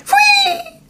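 very high whistling whine; frightened high whine; fearful whine. A narrator's reading. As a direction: whine like a scared dog. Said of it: "Dog whines in fear".

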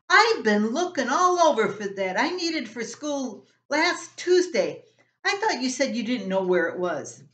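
high, loud indignant outburst; teen yelling at his brother over the lost school book; outraged big brother. Acted. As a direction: blast him for hiding the book. Rob finds his lost book.

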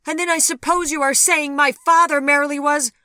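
loud, high indignant accusation; shrill-pitched outrage, cut off; outraged. A narrator's reading. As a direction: throw the accusation out at full pitch. Daughter's outraged outburst in quarrel.

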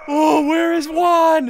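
high, loud, drawn-out call; excited shout. Real, not acted. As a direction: shout it slowly in eager search. Hunting a rare game target.

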